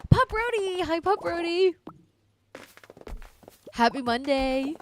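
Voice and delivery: very high, loud greeting; excited squeal. Real, not acted. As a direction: squeal hello at the dog. Greeting the dog in game.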